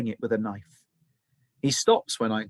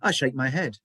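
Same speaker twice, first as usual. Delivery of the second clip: ordinary delivery, nothing marked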